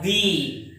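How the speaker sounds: "the" said as thee, with long ee; long ee vowel in "the"; strong form of the. Shown as a demonstration. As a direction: draw out "the" to rhyme with see. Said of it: "have to pronounce this word as".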